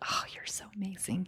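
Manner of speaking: breathy whispered compliment; whispered praise. Pretend. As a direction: whisper it sweetly. Demo of praising kids.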